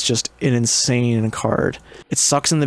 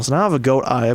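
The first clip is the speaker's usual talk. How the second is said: normal talking voice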